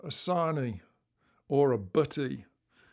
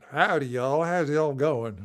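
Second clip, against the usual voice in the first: loud mock Southern US howdy; playful American South accent; 8 dB louder than usual. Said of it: "je parle comme un Américain. ... Pas de la sud".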